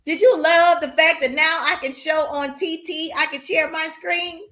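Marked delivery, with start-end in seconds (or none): none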